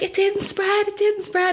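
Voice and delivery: very high, excited retelling without extra volume; excited mock scream. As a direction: blurt out great news, high-pitched and repeated. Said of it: "screaming on the phone, excited".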